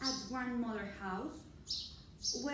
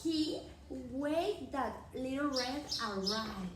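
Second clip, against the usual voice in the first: usual speaking voice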